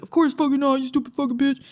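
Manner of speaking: mock scornful kid brother; mock nasty refusal. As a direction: imitate a brother's over-the-top refusal. Brother's refusal, exaggerated in retelling.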